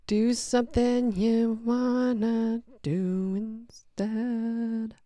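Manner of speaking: put-on slurred, slow drunk wino drawl. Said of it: "I sound like a drunk wino".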